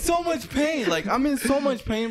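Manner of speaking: high, hammed-up outraged holler; mock-furious, high-pitched cry of pain; exasperated yell. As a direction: wail it at the cheerful passer-by. Acted outburst at cheerful kid.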